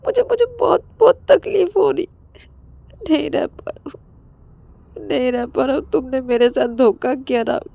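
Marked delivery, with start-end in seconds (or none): 0.1-4.0 high, broken words through tears; stammering, tearful confession; crying. Acted. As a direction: plead while sobbing. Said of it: "Ravi रोते-रोते बोलते".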